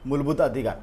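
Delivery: ordinary talk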